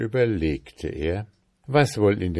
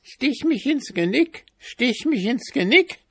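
very high, thin little squeak of a voice; tiny creature calling; 4 dB louder than usual. A narrator's reading. Small creature begs the lad, high tiny calling voice. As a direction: squeal it out like a tiny animal, identically twice. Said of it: "rief die Ameise".

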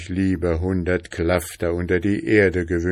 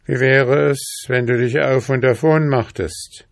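higher, louder sly scheming; sly soldier voice; 6 semitones higher, 5 dB louder than usual. Acted. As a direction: scheme it slyly. Soldier thinks slyly.

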